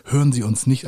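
ordinary delivery

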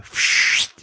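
voiceless hissing swoosh of a throw; imitated whoosh. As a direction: make the noise of something flying. Imitates throwing toilet seats. (gezischtes Wurfgeräusch)

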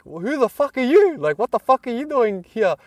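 high-pitched mock indignation, not shouted; imitated hostile challenge. Australian man questioning the unexpected newcomer, imitated hostile challenge. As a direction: act out grilling an intruder. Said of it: "like, literally like this".

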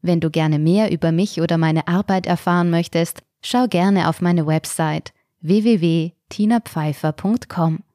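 host closing the story podcast; podcast outro. Acted. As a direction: sign off the episode warmly.

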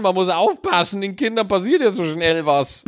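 hoch, spöttisch nachgeäfft; übervorsichtige Eltern verspotten. Retold or imitated. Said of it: "auf einmal passiert, oh". (spöttisches Nachäffen übervorsichtiger Eltern)

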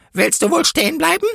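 high-pitched policewoman's cry; calling out. A narrator's reading. Policewoman blocks the angel's way, calling out high. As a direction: call out a shrill halt order. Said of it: "trat ihm in den Weg und rief".

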